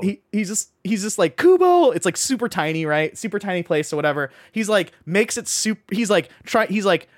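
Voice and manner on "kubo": high, loud happy call of a name; excited shout. Retold or imitated. Friend spotting an old buddy, excited shout. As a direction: yell the name across a tiny restaurant.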